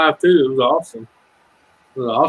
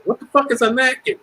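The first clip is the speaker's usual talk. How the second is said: high incredulous mock question; high mocking disbelief; mock baffled local; 10 semitones higher than usual. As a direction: play the confused local, pitch way up. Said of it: "come down here".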